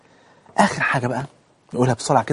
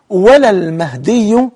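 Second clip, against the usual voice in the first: slow, raised, suspended ending; stretched-out formal Arabic, left hanging; careful hadith recitation; 6 semitones higher, 5 dB louder, much slower than usual. Shown as a demonstration. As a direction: recite louder and slowly, holding back the conclusion. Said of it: "ركز في الحديث".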